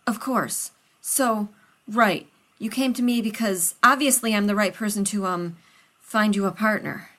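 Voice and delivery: a nervous friend rushing to help; loud and hurried, anxious to please; Rodney, flustered. Acted. Rodney voiced by narrator.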